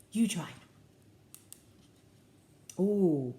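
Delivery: ordinary delivery, nothing marked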